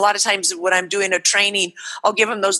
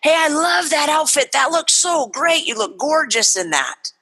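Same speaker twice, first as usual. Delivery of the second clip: upbeat praise; 6 semitones higher than usual. Shown as a demonstration. Acting praise to a child.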